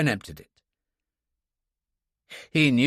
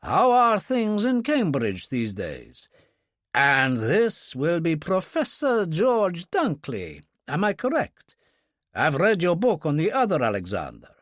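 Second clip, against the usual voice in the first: hint of Russian accent; 6 semitones higher than usual. A narrator's reading. Kurbsky flatters Professor Dunkley, hint of Russian accent. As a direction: turn on the charm, faintly Russian. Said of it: "only a hint of a Russian accent".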